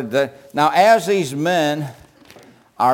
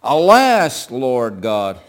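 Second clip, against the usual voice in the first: loud anguished cry of Alas; 2 semitones lower, 5 dB louder, much slower than usual. Shown as a demonstration. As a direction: call out to God in anguish. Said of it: "I imagine he's crying out".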